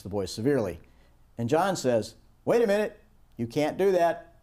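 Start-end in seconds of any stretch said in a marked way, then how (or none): 2.4-4.3 urgent protest. Acted. John stopping angry wife.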